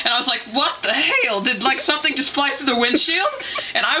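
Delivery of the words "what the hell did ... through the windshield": higher, startled disbelief, reenacted; acted-out alarm; retold freak-out. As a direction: replay your startled outburst in a raised pitch. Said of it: "freaking out".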